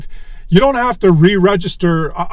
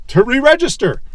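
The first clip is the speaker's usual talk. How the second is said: ordinary talk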